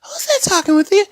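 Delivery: high, loud incredulous question; retold disbelief. Asking sister about the strange voice on playback, retold disbelief.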